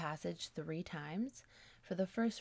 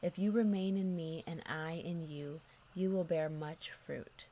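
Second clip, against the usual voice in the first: scripture reading. Acted. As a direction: read the verse out firmly and unhurriedly. Reads the Bible verse aloud.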